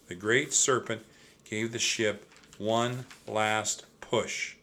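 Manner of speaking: ordinary delivery, nothing marked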